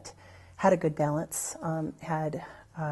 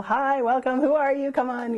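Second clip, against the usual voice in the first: higher and a bit louder, mock-bubbly greeting; imitating gushing churchgoers welcoming newcomers; eager welcome; 8 semitones higher, 4 dB louder than usual.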